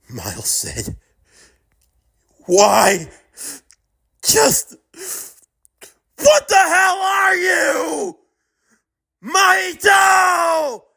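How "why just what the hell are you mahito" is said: high strained yelling at the enemy; furious scream. Acted. Miles screaming at Mahito in rage. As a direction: scream the name with everything.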